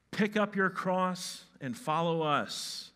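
loud, slow, high banner slogan; drawn-out pretend advertising call; mock slogan. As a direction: proclaim it like a recruiting banner. Reads out imagined banner slogan.

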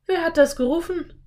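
put-on high girlish startled question. A narrator's reading. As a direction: ask in alarm, pitched well up. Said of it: "fragte sie erschrocken".

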